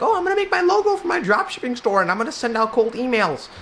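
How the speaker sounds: high, bright put-on voice of a keen beginner; mock eager hustler. Mocking someone starting their dropshipping business, in a high put-on voice. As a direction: squeak it out like an overexcited newbie.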